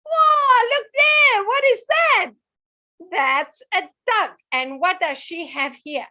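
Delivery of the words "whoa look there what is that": much higher, louder amazed shout; teacher leading children in an amazed, louder shout; excited exclaim. Shown as a demonstration. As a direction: call out in wonder for the kids to copy. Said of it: "And everybody say".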